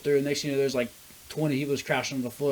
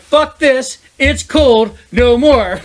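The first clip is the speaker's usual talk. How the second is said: much higher and louder, a hammed-up complaint; mock fed-up yell; 11 semitones higher, 14 dB louder, much slower than usual. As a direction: shout it like a fed-up quitter. Mock quote of someone quitting.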